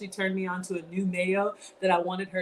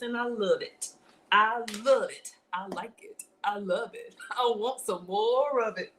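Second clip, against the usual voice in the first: usual speaking voice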